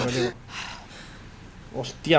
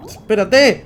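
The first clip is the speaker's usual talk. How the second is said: panicked yell, higher and louder; shouting; 7 semitones higher, 11 dB louder, much slower than usual. Real, not acted. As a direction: shout it at the screen. Shouted take of same word.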